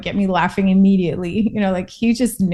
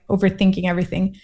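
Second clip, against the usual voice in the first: ordinary delivery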